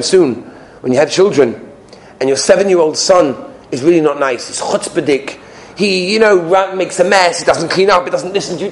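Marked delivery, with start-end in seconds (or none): none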